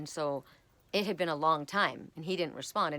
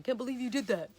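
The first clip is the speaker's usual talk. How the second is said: higher-pitched imitation of an angry ex; mock mad ex-husband; 7 semitones higher than usual. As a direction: lightly act out his scolding. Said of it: "he was mad at me".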